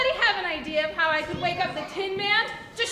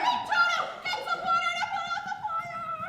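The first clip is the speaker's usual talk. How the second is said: much higher alarmed plea; urgent call; 9 semitones higher than usual. Acted. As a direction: shout for help quickly.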